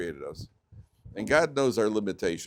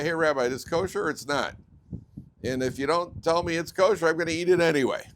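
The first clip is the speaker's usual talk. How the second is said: raised pitch, louder, insistent; pushy demand; 6 semitones higher, 5 dB louder than usual. Acted. Congregant pressing the rabbi about his pizza, pushy. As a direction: press the point like an ultimatum.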